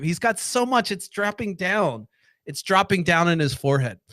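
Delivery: ordinary talk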